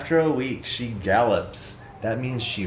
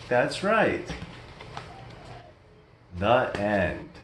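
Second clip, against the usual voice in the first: usual speaking voice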